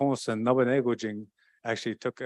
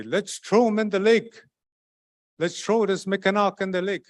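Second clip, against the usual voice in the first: captors deciding; 7 semitones higher, 3 dB louder than usual. Acted.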